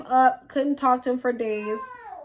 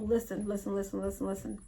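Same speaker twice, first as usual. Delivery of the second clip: hushed pleading; 4 semitones lower, 13 dB quieter, faster than usual. Retold or imitated. Boyfriend pleading quietly.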